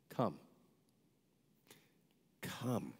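soft, spaced-out single words; quietly beckoning, lightly acted; gentle retold invitation. Mother Teresa inviting student for the summer, gentle.

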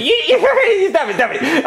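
laughing. Acted. Teased about his birthday.

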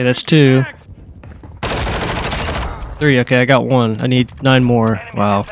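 ordinary talk, nothing marked

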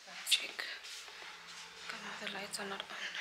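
real hushed whisper. Real, not acted. Checking the dark house, hushed whisper. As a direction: whisper so nobody hears.

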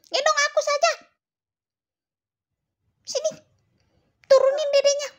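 high, loud squeaky cat voice; kitten character. Pretend. Cat forbids Cici to carry baby, high bossy cat voice. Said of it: "Dedeknya kucing gitu maksudnya".

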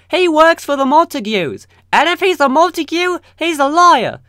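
high, loud accusing lady voice; shrill Lady Capulet. Acted. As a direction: screech the accusation at the prince.

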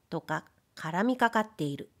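usual speaking voice

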